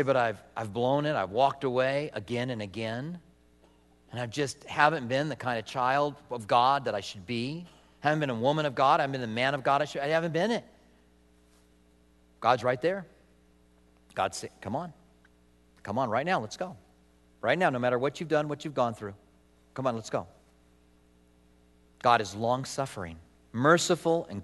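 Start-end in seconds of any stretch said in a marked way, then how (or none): 0.0-10.6 discouraged confession. Acted. Acts out a discouraged believer confessing failure. 14.6-20.3 soft, low, tender urging; gentle coaxing. Acted. God tenderly calls back the failed believer.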